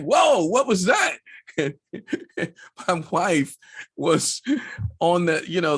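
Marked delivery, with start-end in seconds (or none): 0.0-1.2 high, loud startled yell; sudden alarmed whoa; startled shout. Retold or imitated. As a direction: jolt awake shouting in alarm. Jolted awake by rumble strip.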